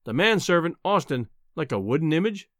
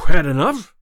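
loud, winded challenge; out of breath, shout it; panting; 11 dB louder than usual. A narrator's reading. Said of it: "he panted".

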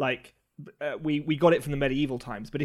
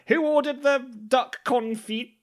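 waiter calling out orders; 9 semitones higher, slower than usual. Retold or imitated. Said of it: "and it's like".

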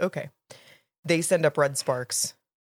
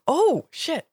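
surprised; 10 semitones higher, 3 dB louder than usual. Real, not acted. Gasp at plot twist.

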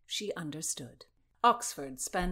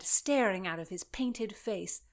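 plain reading voice

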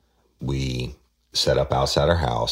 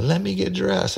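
very high falsetto mock plea; imitating pleading suspect. Woman in pajamas begs detectives, imitated high-pitched pleading. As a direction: squeal it like a hammed-up whine. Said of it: "And she's like".

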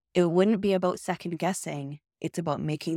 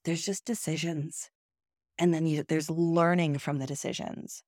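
normal talking voice

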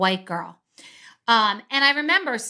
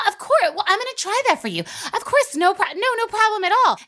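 much higher, sugary waitress voice; super nice high voice; 10 semitones higher than usual. Retold or imitated. The waitress answering the couple, in a much higher overly nice voice. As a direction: sweet-talk him from way up high. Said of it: "And then the voice gets higher and she's super nice".

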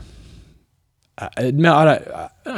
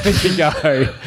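laughing through the words; 4 semitones higher, slower than usual. Real, not acted. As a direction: chuckle it out. Amused answer, then repeated.

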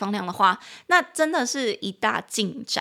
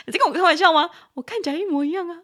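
你在跟我开玩笑吗 laughed out, higher and fast; laughing disbelief; 8 semitones higher, 4 dB louder, much faster than usual. Retold or imitated. Teacher laughing at a friend over identical models, high and fast. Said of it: "就说".

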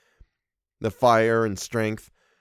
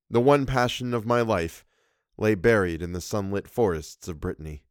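plain reading voice

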